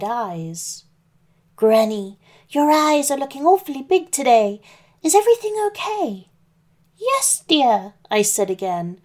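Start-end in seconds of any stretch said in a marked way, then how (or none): 1.6-6.3 put-on higher, louder sweet girl voice; little girl voice. 7.0-7.9 put-on old granny voice. Wolf as Granny.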